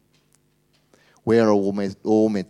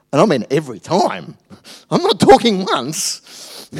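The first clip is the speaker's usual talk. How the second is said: exasperated rant; 8 semitones higher, 10 dB louder than usual. Acted. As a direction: blow up in comic frustration, much louder. Ranting about a slow driver.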